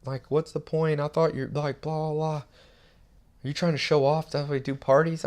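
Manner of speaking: put-on high voice, nagging questions; mocking critic. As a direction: imitate a carping message from a viewer. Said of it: "Somebody wrote in".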